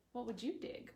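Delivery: normal talking voice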